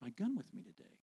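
quiet nudging voice; inner voice. Retold or imitated. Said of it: "this voice is telling me".

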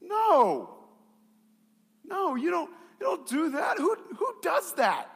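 very high, incredulous protest; indignant. Retold or imitated. Customer told that he was wrong, high indignant outburst. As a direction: exclaim in outraged disbelief. Acts out outrage at being contradicted.

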